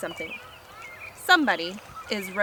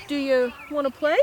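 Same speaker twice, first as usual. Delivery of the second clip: big dog character; 4 semitones higher than usual. Acted. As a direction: ask as a goofy big dog. Maurice invites Fifi to play.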